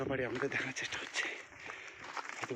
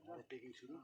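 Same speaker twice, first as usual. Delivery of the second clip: much softer, hushed narration; 11 dB quieter than usual. Real, not acted. To viewers in the jungle, hushed so birds don't fly. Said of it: "ওই জন্য আস্তে আস্তে কথা বলছি".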